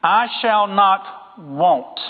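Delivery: each word drawn out very slowly; slow, weighty scripture quote; slow emphatic quote. Shown as a demonstration. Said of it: "this is really important here".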